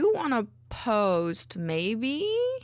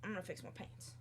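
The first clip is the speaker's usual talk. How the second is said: ordinary talk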